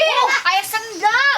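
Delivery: high, laughing yelps of delight; laughing shout. Real, not acted. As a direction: squeal it out through laughter. Kids shout laughing at shop.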